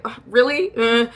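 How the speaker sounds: eye-roll disbelief; disgusted really. Retold or imitated. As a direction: sneer at her claim. Reacting to blonde girl.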